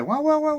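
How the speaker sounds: high yapping barks from a tiny dog; yappy dog barks. Retold or imitated. Imitating the neighbor's tiny dog barking nonstop, high yapping. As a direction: yap like a scared little dog.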